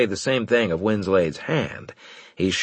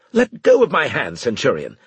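higher, louder angry order; 5 semitones higher, 5 dB louder than usual. A narrator's reading. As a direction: bark it at him. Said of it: "he said angrily".

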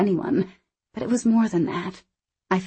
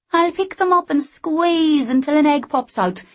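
higher, brighter character voice; lively young Scottish woman; 10 semitones higher, 5 dB louder than usual. Acted. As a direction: play her cheeky and quick. Anna voiced.

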